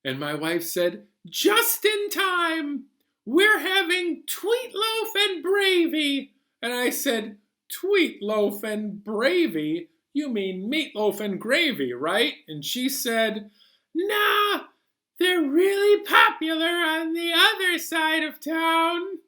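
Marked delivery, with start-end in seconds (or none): 1.3-6.3 high, slow cartoon wife voice; sing-song like a cheerful wife; wife's voice. 7.7-12.3 puzzled question. Acted. Narrator questioning wife. 13.9-19.2 sing-song like a cheerful wife; wife's voice. Acted.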